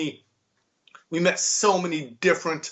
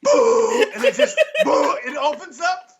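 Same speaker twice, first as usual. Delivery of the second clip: laughing high-pitched retelling, much higher and louder; laughing through story; 12 semitones higher, 3 dB louder than usual. Real, not acted. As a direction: crack up while describing the toilet opening. Retelling toilet dropping.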